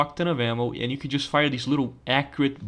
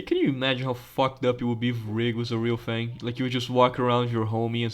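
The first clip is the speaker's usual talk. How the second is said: normal talking voice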